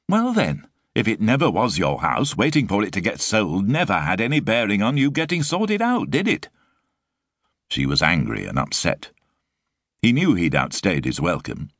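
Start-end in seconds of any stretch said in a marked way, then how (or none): 0.1-6.5 higher, put-upon mother nagging; a harried young mum scolding her lodger; exasperated Sue. A narrator's reading. Sue angry retort.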